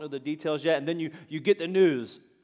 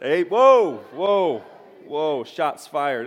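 loud, drawn-out whoa, playfully stung; hammed-up dodge of a jab; mock alarm; 10 dB louder, much slower than usual. Real, not acted. Pastor reacting to a heckle from the pews with loud mock alarm.

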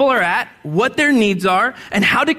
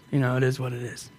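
quiet aside; 9 semitones lower, 9 dB quieter than usual. Real, not acted. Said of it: "aside to congregation".

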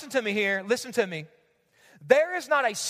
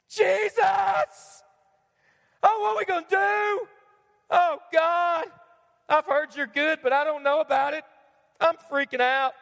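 mock panicked prayer; 2 semitones higher, slower than usual. Preacher mocking a panicked prayer to God, hammed-up fear. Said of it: "Don't we pray like that sometimes?".

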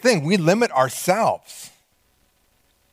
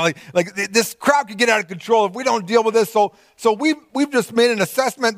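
anxious planning; 7 semitones higher than usual. Acted. Voicing disciples' anxious assessment.

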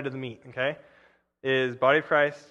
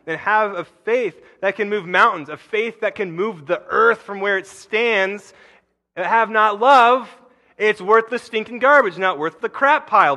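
higher, louder, slowing on the punch line; building fire; 5 semitones higher, 7 dB louder than usual. Shown as a demonstration. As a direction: build to a near-shout on love. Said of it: "this is what that voice says in my head".

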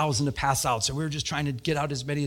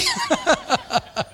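squeaky high laugh; a sheepish high-pitched giggle; 4 dB louder than usual. Real, not acted. Laugh after confession.

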